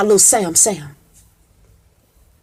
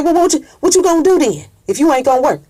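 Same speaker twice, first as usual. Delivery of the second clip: quick high friend voice; 6 semitones higher, faster than usual. Retold or imitated. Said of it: "His friend said".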